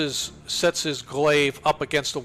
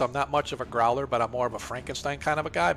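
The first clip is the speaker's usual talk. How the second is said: normal talking voice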